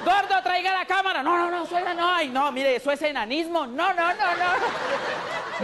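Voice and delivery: a hint of a scandalized, giggling suegra; shocked mother-in-law. Mother-in-law reacts, son-in-law naked.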